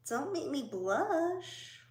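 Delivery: ordinary talk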